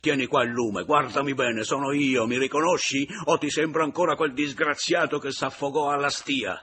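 sustained high, very loud tirade; furious shouting. Acted. Man ordering the widow to look at him, shouting. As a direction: keep yelling, one demand after another.

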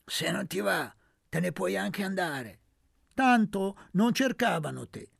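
scornful, looking down on her; contemptuous. Acted. Aunt scorning Fedora, contemptuous. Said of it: "le disse sprezzante".

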